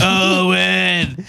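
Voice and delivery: put-on holler without much volume; yelling woman. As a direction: imitate a woman hollering a name. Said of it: "she's yelling the whole time".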